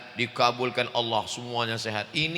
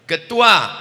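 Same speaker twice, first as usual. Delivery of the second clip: announcing loudly; 7 semitones higher, 11 dB louder than usual. Pretend. Mock announcement of committee roles.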